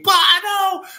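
high, shrieked fan chant of the name, each call held; imitating screaming fans. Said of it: "chicks in the church are screaming".

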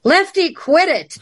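high-pitched call to the back room; scolding a ghost, pitched high; mock yell at ghost. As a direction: shout it high at a noisy ghost. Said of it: "go back there and yell".

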